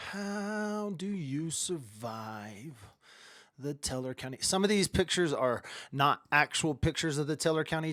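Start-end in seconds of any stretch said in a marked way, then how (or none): 0.1-4.4 slow dramatic tease. Pretend. Teaser title read.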